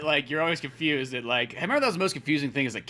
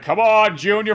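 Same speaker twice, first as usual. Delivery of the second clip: loud dad; 6 semitones higher, 11 dB louder, slower than usual. Pretend. Dad voiced in comic.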